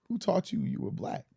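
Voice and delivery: ordinary talk, nothing marked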